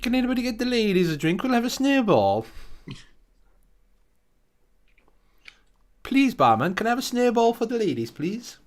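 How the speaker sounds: hamming up a genteel lady at the bar; mock lady voice. Posh ladies ordering snowballs.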